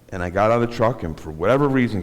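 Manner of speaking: ordinary delivery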